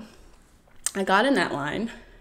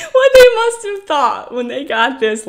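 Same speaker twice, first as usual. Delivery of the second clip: loud, high, through laughter; laughing; 6 semitones higher, 8 dB louder than usual. Real, not acted. Telling listeners about border guards while laughing. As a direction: laugh out the words.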